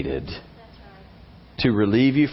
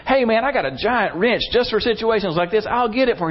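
higher-pitched voice of an eager passerby; acted eager offer, pitched up; helpful bystander; 9 semitones higher than usual. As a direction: imitate a stranger jumping in to help.

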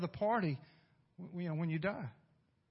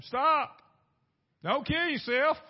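high, loud urgent shout; 10 semitones higher, 8 dB louder, slower than usual. A narrator's reading. Paul shouting to the jailer, loud urgent cry. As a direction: yell to stop him. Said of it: "Paul shouted to him".

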